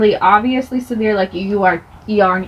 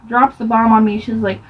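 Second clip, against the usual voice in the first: ordinary talk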